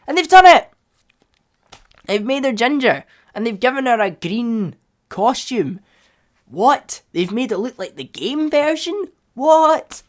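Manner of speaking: high-pitched, hammed-up amazement; playing the astonished fan; excited disbelief. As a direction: burst out in delighted shock. Acts thrilled fan disbelief at change.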